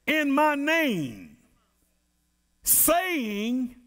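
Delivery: slow, weighty scripture reading; slow, solemn preacher's reading; preaching scripture. Acted. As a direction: let each phrase land like a warning. Reads scripture in preaching cadence.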